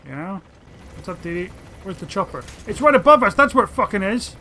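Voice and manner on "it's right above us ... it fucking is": louder, frustrated outburst; exasperated shout. Real, not acted. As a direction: yell it at the dog in disbelief. Finds the chopper, exasperated.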